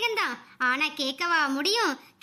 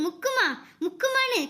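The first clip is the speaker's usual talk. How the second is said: higher-pitched coaching to push; urging nurse; 5 semitones higher than usual. A narrator's reading. Nurse to two women in labour, urging them to push. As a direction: urge them on through the birth. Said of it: "ரெண்டு பேர்கிட்டயும் சொல்லிக்கிட்டு இருந்தா".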